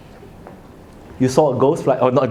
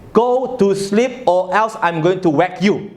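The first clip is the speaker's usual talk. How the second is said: slow, stern parental warning; stern threat; 2 semitones higher, slower than usual. Shown as a demonstration. Parent warns the child the third time, slow stern threat. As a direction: threaten it slowly and firmly. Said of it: "add up with another more words".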